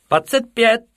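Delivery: high, loud roll-call shout; squeaky yell. A narrator's reading. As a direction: call out your number like a pupil. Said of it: "vykřikl jeden".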